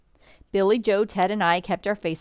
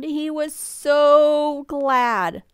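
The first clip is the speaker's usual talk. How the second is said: high, loud, slow and stretched; overjoyed; 8 semitones higher, 6 dB louder, much slower than usual. A narrator's reading. Narrator telling of pa greeting the children, overjoyed. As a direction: draw out SO with swelling delight. Narrator voices pa's joy at reunion.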